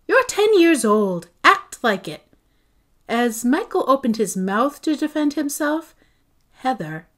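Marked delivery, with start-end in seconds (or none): 0.1-2.2 higher, louder sharp scolding; exasperated scold. A narrator's reading. Mother scolding her ten-year-old son, exasperated. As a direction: snap at him, fed up. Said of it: "Mom looked exasperated".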